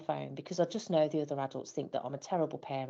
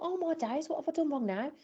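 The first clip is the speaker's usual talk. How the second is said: much higher, alarmed inner voice; retold panic at the chanting kids, high and flustered; panicked thought; 12 semitones higher than usual. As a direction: squeak it out in sudden dismay. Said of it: "and I thought".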